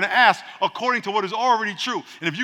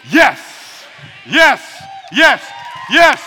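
loud, rising shouted yeses; real preaching shout; fervent yes; 5 semitones higher, 10 dB louder, much slower than usual. Real, not acted. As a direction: shout it with conviction.

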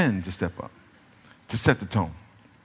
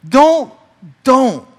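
loud, high-pitched Don't, twice; emphatic shout; 9 semitones higher, 8 dB louder than usual. Acted. Pastor warning young couples, loud emphatic don't. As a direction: bark the warning.

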